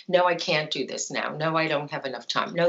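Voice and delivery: ordinary delivery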